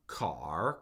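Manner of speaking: "car" dropped very low, dipping then lifting. Shown as a demonstration. Teacher shows tone won't change an English word, dipping tone. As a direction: scoop "car" down to the bottom and up. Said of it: "by changing the tone".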